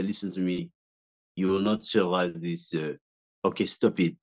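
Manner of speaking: low, menacing gangster voice; a hint of a tough gangster; intimidating French gangster. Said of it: "I had to speak like this and be very intimidating".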